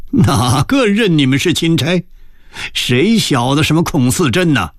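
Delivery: high, scornful challenge; cold sneering. A narrator's reading. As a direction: dismiss them with a cold sneer. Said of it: "咯咯地冷笑着说". (冷笑着拔高声调质问)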